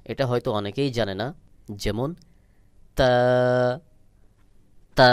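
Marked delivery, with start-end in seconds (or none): 3.0-5.1 তা with a light outward puff of air. Shown as a demonstration. Teacher demonstrates the Arabic letter, তা with airy release. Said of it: "বাহিরের দিকে হালকা বাতাস বের হবে".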